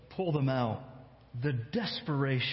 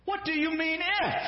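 much higher, incredulous challenge; ticked-off retort; 14 semitones higher than usual. Acted. Jesus snapping back at the doubting father, high and incredulous. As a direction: throw the if back at him.

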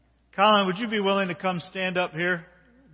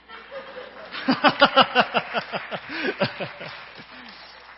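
laughing; 7 semitones higher, 4 dB louder than usual. Real, not acted. Laughs about having no gift.

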